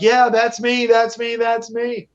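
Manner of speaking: high imitated children's voices; excited little kids. Little nephews answering Santa, imitated high excited voices. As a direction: pipe up high like eager kids, repeating in a rush. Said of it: "And they was like".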